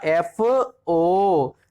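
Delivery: एफ़ओ stretched out very slowly, each sound held; एफ़ओ spoken slowly as a pronunciation model; drawn-out pronunciation. Shown as a demonstration. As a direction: say एफ़ओ syllable by syllable, very slowly and falling at the end. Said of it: "एफ़ओ का मतलब क्या हुआ".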